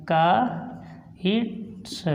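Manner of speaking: Kites stretched very slowly and softly; slow repeat of kites for pupils; kites drawn out very slowly. Shown as a demonstration. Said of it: "उसके बाद तो हम इसको बोलेंगे".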